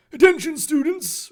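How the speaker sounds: high, loud announcer call; authoritative announcement. A narrator's reading. Reverend addressing students from stage, authoritative loud call. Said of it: "Reverend Dumbledore announced authoritatively".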